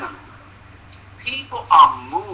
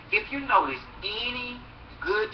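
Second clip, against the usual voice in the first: normal talking voice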